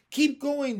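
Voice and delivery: high, loud urging shout; cried encouragement. A narrator's reading. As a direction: cheer them on. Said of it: "they cried".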